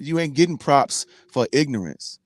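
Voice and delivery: normal talking voice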